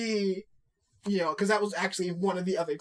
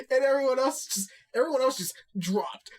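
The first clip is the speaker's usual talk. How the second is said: normal talking voice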